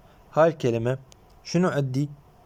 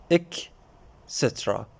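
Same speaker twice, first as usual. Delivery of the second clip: إك then a pause, then سترا dropped low and soft; syllables split apart; 4 semitones lower, 4 dB quieter, slower than usual. Shown as a demonstration. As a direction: break إك from سترا with a clear gap. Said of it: "فش تصير؟". (تقسيم المقاطع إك ثم وقفة ثم سترا)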